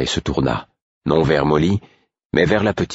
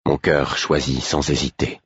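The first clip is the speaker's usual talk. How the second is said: plain reading voice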